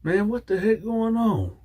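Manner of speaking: high-pitched, exasperated question; acted bewilderment. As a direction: demand to know what's happening. Acts out his alarmed question.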